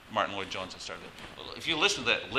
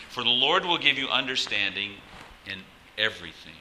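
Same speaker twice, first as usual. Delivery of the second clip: scripture reading; 7 dB louder than usual. Acted. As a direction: proclaim the verses like a preacher.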